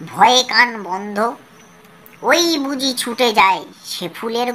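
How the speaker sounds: slow, rhythmic reading of a nonsense rhyme; slow rhyme recitation. Shown as a demonstration. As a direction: recite each sound word slowly and clearly for the class. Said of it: "আমি কিন্তু ওই জন্য আস্তে আস্তে কিন্তু তোমাদেরকে পড়ে শোনালাম".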